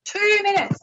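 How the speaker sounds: very high-pitched exclamation; startled alarm. Real, not acted. Host learns the break is near, startled alarm. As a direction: blurt it in real surprise at the time left. Alarmed at how little time remains.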